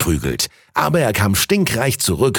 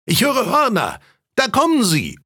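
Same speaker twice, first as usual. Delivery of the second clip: much higher-pitched cry from the crowd; excited calling out; 13 semitones higher than usual. A narrator's reading. Onlookers announce the duke's procession, excited high call. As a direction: call out the first sighting, high-pitched without extra volume. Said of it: "ertönten die ersten Rufe".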